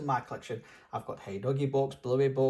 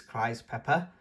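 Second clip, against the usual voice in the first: usual speaking voice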